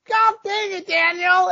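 very high, strained mock exasperation; exasperated mom. Mom scolding dad after his joke, exasperated high whine.

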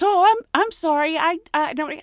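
high, loud flustered stammer; embarrassed apology. Retold or imitated. Coworker apologising to Betty, high and flustered. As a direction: stumble over the apology. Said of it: "she goes".

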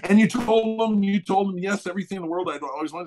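retold excited prompting; insistent expectation. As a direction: imitate an over-eager manager pressing for a yes. Manager prompting him, expecting a yes.

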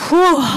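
big high loud exhale of relief; loud relieved whew. Real, not acted. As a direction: let the relief blow out. Relief after client's praise.

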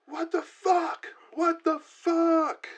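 slow high yell; drawn-out confused shout. A narrator's reading. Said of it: "and then yelling".

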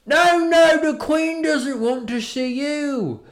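high, loud and slow, a hammed-up stiff guard; mock security guard. Guard turning away tourists at the palace, hammed-up and slow. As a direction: put on a pompous palace guard voice. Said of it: "the security guard or whatever was like".

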